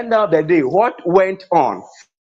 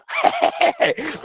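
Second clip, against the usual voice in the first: amused laugh; 11 semitones higher, 4 dB quieter than usual. Real, not acted. Laughs at the name Alabama.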